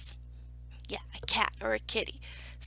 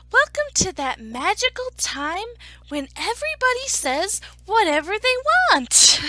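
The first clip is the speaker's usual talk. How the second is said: loud, high, triumphant build; grand welcome; 9 semitones higher, 11 dB louder than usual. Acted. Story narrator welcoming everybody to the magical time, grand and loud. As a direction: proclaim it like a big finale.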